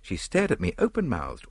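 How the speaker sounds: usual speaking voice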